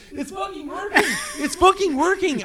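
a boxer's thrilled high yelp mid-fight; excited disbelief. Retold or imitated. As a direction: yelp it in delighted surprise. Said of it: "He's like".

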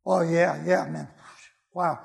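usual speaking voice

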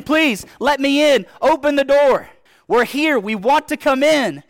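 loud, high begging at the door; desperate pleading. Pretend. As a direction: beg to be let in, pounding and calling out. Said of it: "and you're saying".

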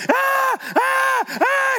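short, very high-pitched "ah" squeals; little squeals of a thrilled kid, imitated; imitated excited squeals. Grandfather imitating granddaughter climbing the hill, excited squeals. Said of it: "she's going".

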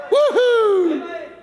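high joyful whoop with a laugh; excited whoop. Real, not acted. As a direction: whoop for joy.

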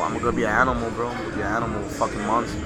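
usual speaking voice

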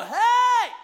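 high-pitched hey, calling out; retold yell. Seventh grader calls out to kids beating up another kid, yelling. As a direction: re-enact yelling to stop a fight. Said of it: "And I yell".

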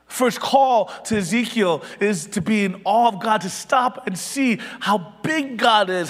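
loud, rising, impassioned sermon delivery, peaking in a shout; full-force preaching. Real, not acted. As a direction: preach it like you're calling the congregation to awe.

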